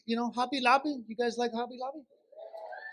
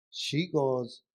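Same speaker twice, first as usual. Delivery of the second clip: ordinary delivery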